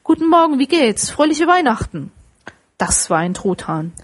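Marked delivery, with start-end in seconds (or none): 0.0-2.1 high, merry greeting; cheerful greeting. A narrator's reading. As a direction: call out a gleeful Christmas hello, pitched up. Scrooge greets the turkey delightedly. (fröhlich und hoch grüßend)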